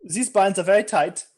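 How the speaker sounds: put-on high, loud, pleading German accent. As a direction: beg to go in a caricature German accent. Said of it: "The German sound individual".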